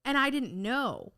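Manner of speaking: ordinary talk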